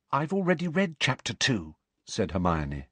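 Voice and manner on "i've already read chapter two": higher-pitched, keen schoolgirl voice; clear, carrying voice. A narrator's reading. Pupil answers the teacher about her reading, clear carrying voice. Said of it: "speaking in a clear, carrying voice".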